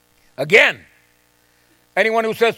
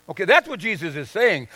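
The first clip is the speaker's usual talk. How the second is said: ordinary talk, nothing marked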